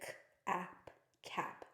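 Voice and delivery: k, ap said apart, then cap blended softly; segmented sounding out. Shown as a demonstration. Teacher showing how a student decodes cap, sounded out. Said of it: "then they would touch it".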